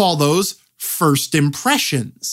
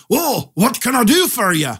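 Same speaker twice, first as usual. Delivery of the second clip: hammed-up Scottish blacksmith, pitched higher; Scottish accent; 6 semitones higher than usual. Game master as blacksmith greeting a customer, Scottish accent. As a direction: put on a stock Scots shopkeeper brogue. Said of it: "They put on a Scottish accent".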